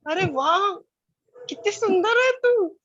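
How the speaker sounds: put-on high, loud, slow delighted cry to a little girl; delighted gush. Greeting her child self in a garden, high delighted gush. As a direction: marvel at her like a delighted aunt. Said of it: "तो मैंने उससे बोला".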